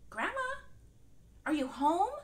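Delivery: put-on girl calling for grandma. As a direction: call through the door.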